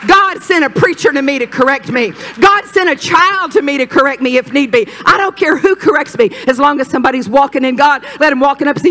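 loud, high, driving preacher delivery; impassioned pulpit cry; fervent preaching. Real, not acted. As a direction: preach it out with full conviction. Preaching at full force.

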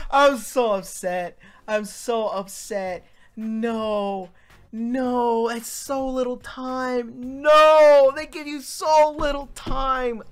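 loud, high distressed complaining; real upset loud lament; upset wailing. Real, not acted. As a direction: whine loudly about the time limit. Loss of the quiz.

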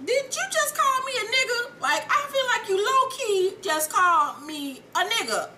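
high-pitched mock disbelief; incredulous. Acted indignant disbelief.

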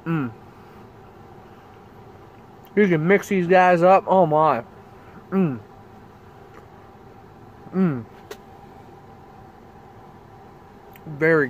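muffled closed-mouth murmurs; savoring while chewing; chewing with mouth full. Real, not acted. Seen 0: Bites dunked cookie at -5; mouth closed, chewing through "mm"s.

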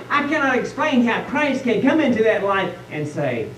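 ordinary talk

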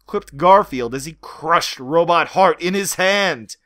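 ordinary delivery

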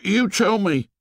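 anxious. A narrator's reading. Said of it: "said Piggy anxiously".

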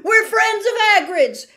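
high, loud desperate shout. Acted. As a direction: yell it out in panic. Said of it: "Harry shouted".

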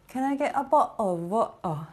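put-on half-hearted Cockney accent, amused; mock Cockney accent. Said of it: "like Cockney or".

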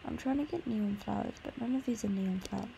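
usual speaking voice

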